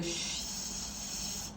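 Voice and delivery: sh- with spread smiling lips, hissed quietly; smiled sh. Shown as a demonstration. Teacher shaping sh toward the soft ch. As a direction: smile wide and hiss sh. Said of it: "stretch your lips and smile with the".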